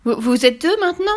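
put-on a touch of alarm in a child's voice; startled boy. A narrator's reading. Boy discovering a second witch, startled.

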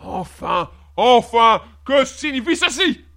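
loud, exploding royal fury; purple with rage. A narrator's reading. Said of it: "le visage violacé de rage". (violacé de rage, explose)

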